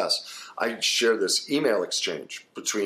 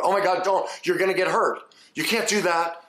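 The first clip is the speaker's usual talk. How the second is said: imitating an overanxious parent crying out; panicky doomsday parent; 10 semitones higher than usual. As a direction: mimic a frantic worried parent. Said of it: "the incessantly worried, the doomsday parent".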